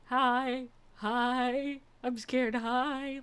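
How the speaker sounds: a hint of a timid kitten. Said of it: "he was just like".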